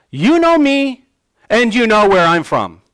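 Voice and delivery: loud, high mocking cry; sarcastic cry. Jesus crying out to the doubters in the temple, sarcastically. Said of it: "He cries out sarcastically".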